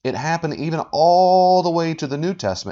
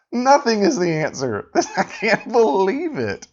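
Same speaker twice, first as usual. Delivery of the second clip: higher-pitched, laughing incredulity; laughing in disbelief; 7 semitones higher than usual. Real, not acted. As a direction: laugh through the words, baffled. Laughing at an absurd argument.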